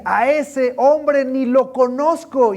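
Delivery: slow, loud, high denial with an edge; cursing denial. Acted. Peter swearing he never knew him, loud slow denial. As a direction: spit out the denial slowly.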